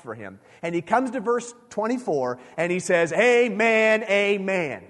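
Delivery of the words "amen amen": loud, ringing declaration of Amen, amen; intense proclamation. Acted. Jesus announcing a divine statement to listeners, loud and intense. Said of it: "Really intense stuff".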